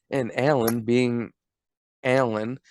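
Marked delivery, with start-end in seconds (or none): none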